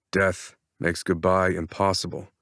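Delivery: plain reading voice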